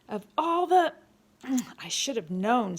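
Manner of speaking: loud, exasperated, breaking off in disgust; disgusted exclamation. A narrator's reading. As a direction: burst out, then grumble at yourself. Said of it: "in disgust".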